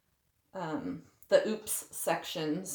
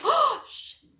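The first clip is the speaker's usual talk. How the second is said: high startled yelp; 6 dB louder than usual. Real, not acted. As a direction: caught off guard by the spill. Paint spill surprise.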